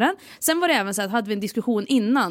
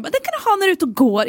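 lightly raised, brisk motherly advice; mom's voice; 8 semitones higher, faster than usual. Retold or imitated. Mom telling daughter about the reflective vest, lightly mimicked. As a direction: quote mom a bit higher and quicker. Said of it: "hon sa verkligen".

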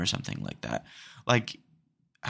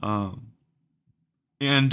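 usual speaking voice

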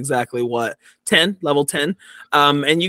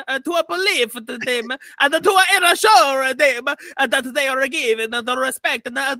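Mussolini speech; 9 semitones higher than usual. Acted. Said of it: "Mussolini exits the stage".

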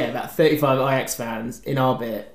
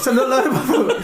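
laughing; 9 semitones higher than usual. Real, not acted. Echo with laugh.

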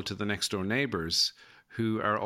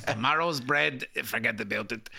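mock New York mobster; light gangster voice, forget about it; De Niro impression; 2 semitones higher than usual. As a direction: brush it off like a mafia boss. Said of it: "in that De Niro".

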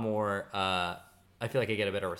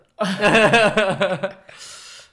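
high, loud burst of laughter; 10 semitones higher, 14 dB louder than usual. Real, not acted. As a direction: crack up loudly at your own joke. Laughs at own Hamilton joke.

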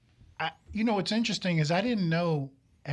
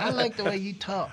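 lightly imitated admiring girl, a bit higher; swooning girls; 5 semitones higher than usual. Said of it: "all the girls are like".

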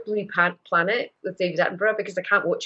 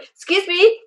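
excited announcement; 11 semitones higher, 8 dB louder than usual. Retold or imitated. Retold telling postman her news.

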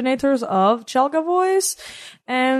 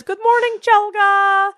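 high, bright-sounding greeting, louder; mock teacher greeting; 11 semitones higher, 4 dB louder, slower than usual. Daughter imitates her teacher greeting her, in a high mock voice. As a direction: imitate the teacher welcoming you. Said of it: "she says".

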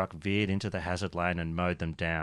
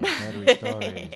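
echo effect; 12 semitones higher than usual. Acted. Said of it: "turn that effect off".

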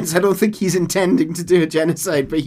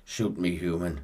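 lower, softer creature voice; a hint of a beaten beast begging; weary Sasquatch; 8 semitones lower, 9 dB quieter, slower than usual. Last Sasquatch begs John to shoot him, low and soft.

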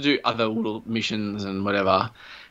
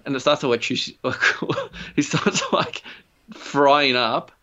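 ordinary talk, nothing marked

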